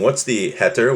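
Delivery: ordinary delivery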